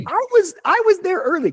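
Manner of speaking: high-pitched imitation of indignant protest; mock frustration. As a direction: act out a frustrated claim, pitch way up. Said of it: "Do you feel frustrated?".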